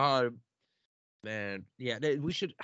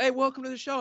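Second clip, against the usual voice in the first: higher, cheery mock host welcome; mock guest greeting; 10 semitones higher, faster than usual. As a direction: parody a guest stealing the host's line. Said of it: "they're just gonna say".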